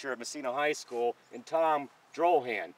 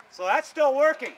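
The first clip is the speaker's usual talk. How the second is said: excited; 9 semitones higher, 7 dB louder than usual. Real, not acted. After the ride, excited.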